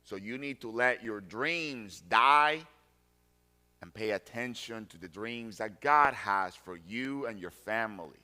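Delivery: ordinary talk, nothing marked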